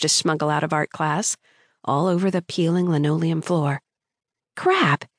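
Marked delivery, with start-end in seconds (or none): 4.6-5.1 high startled cry of crap; startled yelp. A narrator's reading. Girl spilling her pencils, yelps in alarm. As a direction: blurt it out in sudden panic. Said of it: "I yelled".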